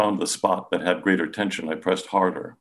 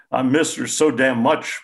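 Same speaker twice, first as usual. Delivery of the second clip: higher, louder tearful cry; grieving father breaking down, choked and tearful; choked out through tears; 7 semitones higher, 6 dB louder than usual. A narrator's reading. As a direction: force the words out through tears. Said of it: "Through his tears, he choked out".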